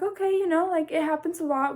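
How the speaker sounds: bright, reassuring voice; a hint of cheerful helper; reassuring man. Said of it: "he's like".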